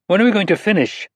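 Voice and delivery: put-on high, earnest child voice; a young girl scolding a grown-up; little girl. Narrator voices young girl Anna.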